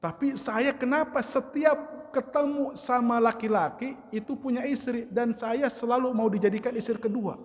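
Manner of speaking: high, plaintive mock wail through the words; imitated tearful complaint. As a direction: play a crying teacher pouring out her grievance. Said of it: "aduh, nangis lagi". (tiruan curhat sambil menangis, bernada tinggi)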